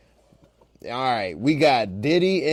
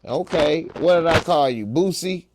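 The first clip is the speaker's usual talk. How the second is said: ordinary talk, nothing marked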